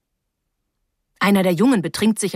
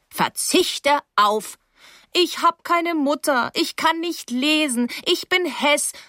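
higher, slow mocking child; mocking whine; 8 semitones higher, slower than usual. Paula mimicking the sulking girl, higher and slow. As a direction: parody the girl's whining, higher. Paula mocks the girl's complaints.

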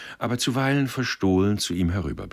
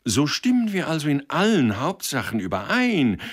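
higher and louder, carried away with delight; enthusiastic exclamation; 10 semitones higher, 5 dB louder than usual. Acted. Hoheneichen exclaiming enthusiastically to Sender across the table. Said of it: "rief Hoheneichen begeistert".

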